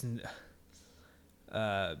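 usual speaking voice

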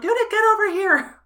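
high loud scream out window; screaming name. Acted. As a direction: scream it, frantic and oblivious. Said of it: "still screaming for you".

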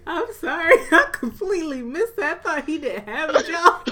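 high voice breaking into laughter; sheepish, giggly confession; laughing apology. Real, not acted. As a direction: apologize while cracking up. Embarrassed lapse, laughing apology.

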